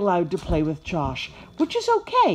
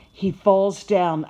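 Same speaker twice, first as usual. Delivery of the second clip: ordinary delivery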